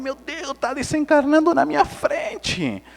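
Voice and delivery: high-pitched mock alarm; comic high-voiced dismay; retold panic. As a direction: act out a panicked realization. Retold panic as nephew drowns.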